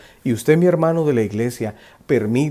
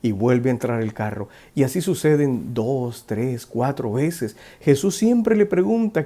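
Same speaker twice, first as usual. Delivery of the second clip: ordinary talk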